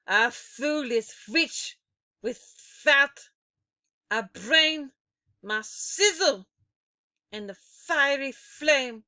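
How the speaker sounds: slow, loud chanted threat; menacing witch rhyme. A narrator's reading. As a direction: chant the doom rhyme with relish.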